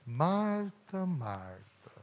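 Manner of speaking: put-on a loving father gently correcting a small child; fatherly patience. Jesus gently calling Martha, lightly fatherly. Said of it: "com um tom assim de um pai".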